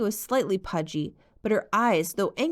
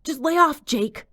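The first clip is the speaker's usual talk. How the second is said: a shout of anger heard from below, loud and high; muffled shout; 9 semitones higher, 8 dB louder than usual. A narrator's reading. Boy from the stairwell yelling at Jake, a loud muffled shout. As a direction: yell at someone to back off. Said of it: "she heard a muffled shout".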